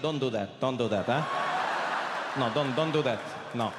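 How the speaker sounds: low, deadpan fatherly refusal; imitating an unimpressed dad; disapproving dad. Dad dampens the proud reveal.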